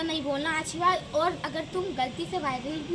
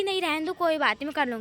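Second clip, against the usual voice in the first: ordinary talk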